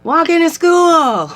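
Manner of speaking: high, loud and slow shouted reply; yelling back. Retold or imitated. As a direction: call it back across the street. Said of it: "So I yelled back".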